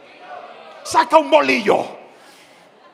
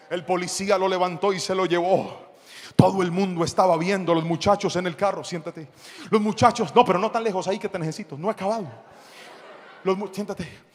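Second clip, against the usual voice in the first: ordinary talk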